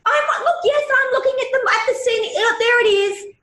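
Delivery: hammed-up irritated mum insisting she's watching; exasperated mum. Exasperated reply to kids.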